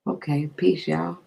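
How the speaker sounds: ordinary talk, nothing marked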